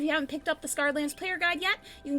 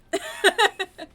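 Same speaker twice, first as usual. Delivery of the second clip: high, loud burst of laughter; 7 semitones higher, 6 dB louder than usual. Real, not acted. Host reassuring a co-player about canon, real laughing.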